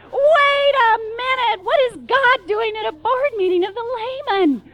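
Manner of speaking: crying out at God's board meeting; incredulous shout. Acted. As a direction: shout it in outraged astonishment. Outraged realisation about God.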